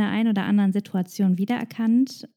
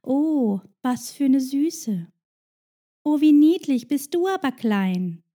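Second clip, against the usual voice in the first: cooing stranger; 4 semitones higher than usual. Retold or imitated. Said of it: "dieser Satz".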